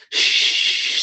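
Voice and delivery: firm, drawn-out "shh"; forceful shush. Retold or imitated. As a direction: shush a crying baby forcefully. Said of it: "trying to force her to".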